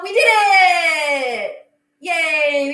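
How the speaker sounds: loud, high cheer of victory; triumphant shout. Real, not acted. Cobbler finished, she cheers loudly to viewers. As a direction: yell it like you won.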